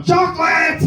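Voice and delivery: sudden manic yell; hyped-up shout. Acted. Comic confesses to being hyped on chocolate, shouting. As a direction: blurt it out as a hammed-up sugar-rush shout. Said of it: "I'm obviously hyped up on".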